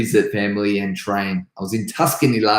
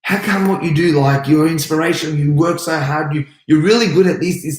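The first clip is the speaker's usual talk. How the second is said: higher, louder gushing admirer; lightly voiced starstruck student; admiring fan; 4 semitones higher, 4 dB louder than usual. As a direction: gush like a fan who's impressed. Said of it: "people will always come up to you and go".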